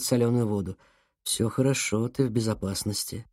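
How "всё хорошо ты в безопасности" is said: soft, low reassurance; calm the boy softly; gentle. Acted. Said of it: "мягко сказал Конрад".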